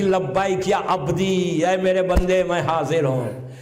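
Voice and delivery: lingering, drawn-out reply from above; slow divine answer. Acted. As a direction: stretch each word like a voice from heaven. God answers Moses from above.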